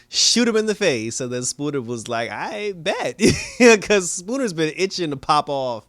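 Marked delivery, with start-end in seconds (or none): 0.1-1.2 raised, higher threat; mock shout, half laughing; mock order. Retold order to shoot. 2.3-3.2 higher, eager put-on voice; hammed-up eagerness; eager Spooner. 3.2-3.8 loud, high laugh; real laugh bursting out; burst of laughter. Real, not acted. Laughs at own story. 3.8-5.9 words through leftover laughter; real laughter in the voice; laughing while talking. Real, not acted.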